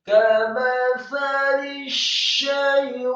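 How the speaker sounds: slow, melodic chant with long held vowels; sustained, singing tartil; Quran recitation. Real, not acted. Teacher models the verses for learners, Quran recitation.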